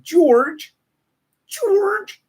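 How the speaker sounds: mock exclaim. Host mock-squealing George Bush's name.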